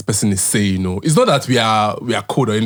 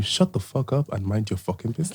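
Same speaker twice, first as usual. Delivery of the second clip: blunt quiet put-down; 3 semitones lower, 9 dB quieter than usual. Retold or imitated. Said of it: "most direct but subtle way".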